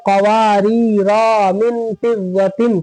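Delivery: "कवारीरा मिन फिज़्ज़तिन" slowly, with final raa wrongly held long; common misreading. Retold or imitated. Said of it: "लोग क्या पढ़ते हैं?".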